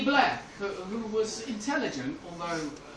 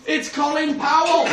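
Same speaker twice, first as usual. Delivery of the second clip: loud, high, proud shout; triumphant; 7 semitones higher, 11 dB louder than usual. Acted. Helms gives Bush his answer to the riddle, triumphant.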